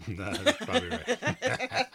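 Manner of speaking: words squeezed out through high laughter; cracking up at the dead cows; laughing. Real, not acted. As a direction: laugh while saying it slowly. Laughing at reader's joke.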